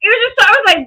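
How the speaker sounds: high, through laughter; laughing. Real, not acted. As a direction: giggle through the words. Laughing at co-host's robot bit.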